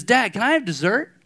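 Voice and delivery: son asking. Retold or imitated. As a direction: ask it like a hopeful kid. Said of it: "And my son says".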